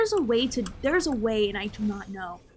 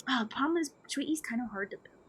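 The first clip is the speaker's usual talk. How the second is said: soft whispered confession; hushed, breathy aside to chat; 8 dB quieter than usual. Real, not acted. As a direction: whisper it like a secret. Aside about a hard build.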